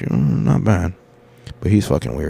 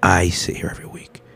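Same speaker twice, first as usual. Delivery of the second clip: whispered, much softer; inner thought; 8 dB quieter than usual. Retold or imitated. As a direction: breathe the words as if only in your head. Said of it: "in my head, I'm like".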